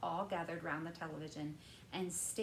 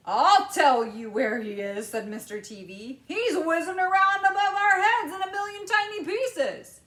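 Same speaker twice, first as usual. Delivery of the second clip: louder, higher sarcastic father; bitter father; 11 semitones higher, 15 dB louder than usual. A narrator's reading. Father answering bitterly, loud. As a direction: spit out the grim answer. Mr TV voiced.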